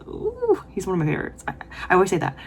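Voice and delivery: ordinary talk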